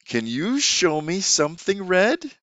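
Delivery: put-on teacher asking a child to find something red, slow and inviting. Shown as a demonstration. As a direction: ask it slowly and invitingly. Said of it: "another thing you could throw in".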